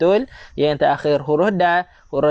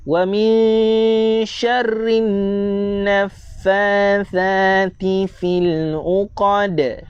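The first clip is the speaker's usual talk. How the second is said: slow, higher, drawn-out tajwid recitation; Quran recitation; 4 semitones higher, much slower than usual. Shown as a demonstration. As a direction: chant each syllable slowly for students to follow. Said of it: "Tuan-tuan di rumah boleh baca-baca bersama saya". (bacaan tartil perlahan)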